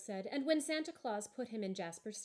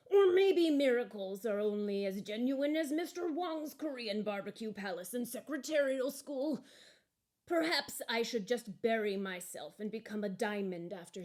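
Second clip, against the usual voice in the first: lightly voiced lump of coal; 3 semitones higher, 6 dB louder than usual. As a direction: wonder aloud, a little bitter.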